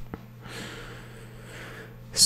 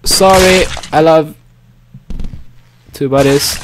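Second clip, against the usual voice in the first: ordinary talk, nothing marked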